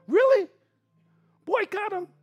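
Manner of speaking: high mock disbelief; incredulous. Real, not acted. Pastor mocking coffee boycott, high incredulous.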